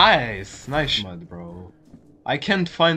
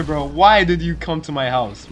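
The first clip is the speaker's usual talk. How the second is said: ordinary talk, nothing marked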